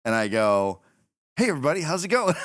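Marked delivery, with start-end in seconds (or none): 1.4-2.3 breezy high greeting. Retold or imitated. Greets crowd as if casual.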